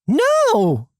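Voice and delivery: high, drawn protest; acted indignant no; emphatic refusal. Snaps back in an argument.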